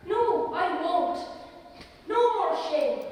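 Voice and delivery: a resolute, slow no; firm declaration. Acted. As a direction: plant each word like a vow. Priest's firm refusal.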